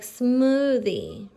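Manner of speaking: "smoothie" with a long, slow "sm"; blended onset. Shown as a demonstration. Teacher giving an example of the blend, drawn out. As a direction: stretch the blend at the start of "smoothie". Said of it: "It's one sound".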